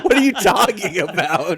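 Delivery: high, loud question through laughter; laughing disbelief. Real, not acted. To a friend who said fright, laughing disbelief. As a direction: crack up as you question them. Cracking up at wrong word.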